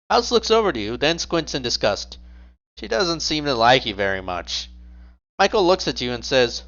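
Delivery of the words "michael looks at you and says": ordinary delivery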